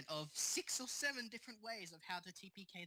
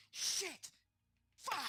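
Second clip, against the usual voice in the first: retold shout; 9 semitones higher than usual. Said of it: "why I was going".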